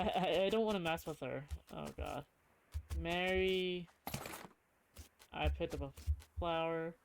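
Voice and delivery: ordinary delivery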